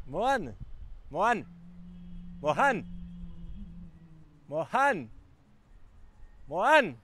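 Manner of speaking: high-pitched call, rising and falling on the name. Real, not acted. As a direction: call repeatedly for someone out of sight. Calling his friend away from camera.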